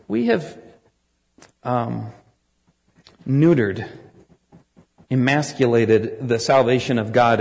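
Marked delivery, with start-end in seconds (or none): none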